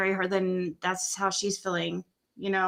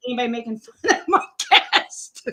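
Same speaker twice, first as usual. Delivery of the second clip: laughing; 2 semitones higher, 4 dB louder than usual. Real, not acted. Defending guest while laughing.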